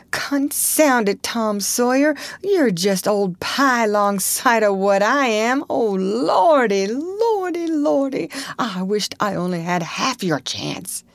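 put-on high, drawn-out boyish lament; tearful wailing. A narrator's reading. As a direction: wail despairingly to your friend. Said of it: "Huckleberry began to snuffle too".

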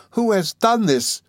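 high, slow, dramatic exclamation; exclaiming. A narrator's reading. Friend finds the girl in distress, exclaiming. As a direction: demand it in a high, drawn-out voice. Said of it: "exclaimed Miss Mills".

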